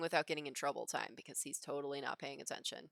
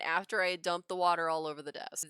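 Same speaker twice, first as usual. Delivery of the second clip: ordinary talk, nothing marked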